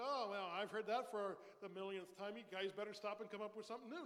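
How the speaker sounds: loud, weary mock complaint; mock bored listener. Pastor voicing God as he would never sound, mock bored. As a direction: play a jaded listener tired of the same songs. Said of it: "He's never said".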